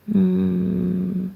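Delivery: normal talking voice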